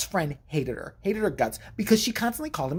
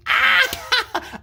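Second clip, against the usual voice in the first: shocked yelp; 5 dB louder than usual. Real, not acted. Reaction to sister's question.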